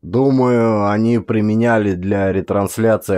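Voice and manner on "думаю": ordinary delivery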